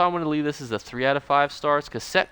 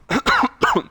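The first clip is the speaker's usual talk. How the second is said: harsh real cough; coughing fit; 13 semitones higher, 6 dB louder than usual. Real, not acted. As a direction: cough hard, voice failing. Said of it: "I'm losing my voice".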